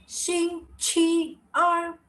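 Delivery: 星期二 slow and high, each syllable held; careful demonstration. Shown as a demonstration. Teacher confirms second day of week, slow careful demonstration. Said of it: "that's the second day of the week".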